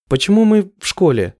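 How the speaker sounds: a puzzled, rising question; surprised question. A narrator's reading. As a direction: ask in genuine surprise. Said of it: "с удивлением поворачиваюсь к нему". (удивлённый вопрос)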